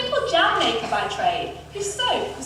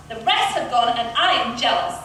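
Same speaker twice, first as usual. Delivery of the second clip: usual speaking voice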